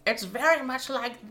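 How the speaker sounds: rich, over-the-top upper-crust voice; Thurston Howell. Retold or imitated. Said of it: "I do recognize the voice".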